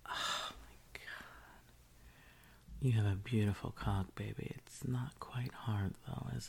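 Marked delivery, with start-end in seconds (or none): 0.1-1.7 hushed mock awe. Mock awe at what she sees.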